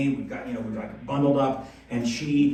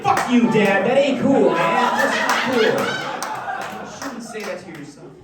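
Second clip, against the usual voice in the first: higher, louder mock protest at dad; hammed-up hurt yell, higher and louder; mock outraged son; 6 semitones higher, 3 dB louder than usual. Son snapping back at his dad, mock outraged yell. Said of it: "I was like".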